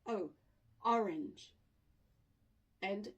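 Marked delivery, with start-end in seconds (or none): 0.1-1.5 O alone, a pause, then ORANGE stressed at the front; first syllable isolated, stressed. Shown as a demonstration. Teacher showing the first syllable, isolated and stressed. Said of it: "on the first syllable".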